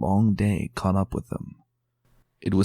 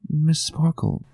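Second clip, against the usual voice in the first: put-on higher, small childlike voice; a shy filly asking; young filly voice; 8 semitones higher than usual. A narrator's reading.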